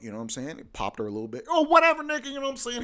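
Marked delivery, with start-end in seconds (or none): 1.5-2.8 high mocking exclamation. Brushing off the cat's reaction.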